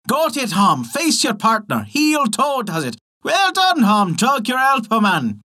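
high, rising whoops of a dancing imp; excited cheering. A narrator's reading. Changeling cheers Hom as he dances, rising to a shout. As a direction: egg the fiddler on, building to a shout.

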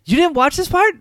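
high, loud disbelieving question; can't believe she skipped it; incredulous yelp; surprised. Real, not acted. Disbelief at cohost.

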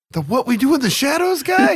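high, loud incredulous question; surprised disbelief. Retold or imitated. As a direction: sound amazed and unsure. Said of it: "I was like".

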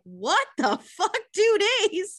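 high, loud shriek of disbelief; hammed-up panicked rabbit; shocked outrage. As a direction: squeal it in horror. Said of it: "rabbit is like".

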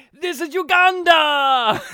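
slow, loud, high booming yell; mock action-movie announcer yell; hammed-up announcer shout. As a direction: bellow it like a hype man. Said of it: "and then V J Amy".

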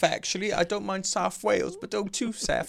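1 mock grumpy listener. Co-host keeps outraged listener bit.

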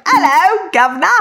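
high-pitched, hammed-up British greeting; terrible British accent. Shown as a demonstration. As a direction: do a badly faked British accent. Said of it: "I'm terrible with accents".

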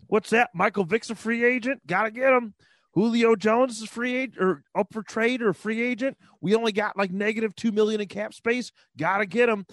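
excitable, high-pitched Bills accent impression; Bills fan accent. Mocking fans who want every free agent, Bills fan accent. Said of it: "that's my Bills accent".

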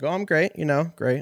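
sarcastic fake-happy reply; fake cheerful. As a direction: oversell how great you are. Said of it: "I'm like".

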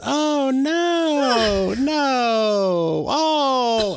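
high, loud squeal of dismay imitating Batavia; imitating a friend crying out over a baby squirrel; mock dismay. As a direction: squeak out a pitying no, no. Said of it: "And Batavia goes".

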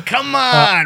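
ordinary talk

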